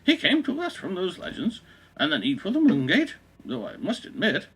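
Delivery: put-on high, slow old English lady voice; old English woman. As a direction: read it as a prim elderly Englishwoman. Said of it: "this should be like an old English woman".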